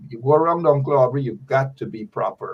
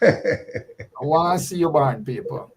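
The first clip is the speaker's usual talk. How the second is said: laughing; 3 semitones higher, slower than usual. Acted. Joking demand to President Granger.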